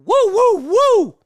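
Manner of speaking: high, loud repeated whoops; whooping. Real, not acted. Streamer celebrating the finished chug, whooping. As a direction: celebrate with three big whoops.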